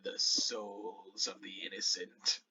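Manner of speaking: slow, low, hushed voice; sinister sword whisper. Acted. Cursed sword on the bearer's back, whispering slowly. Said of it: "you hear it whisper".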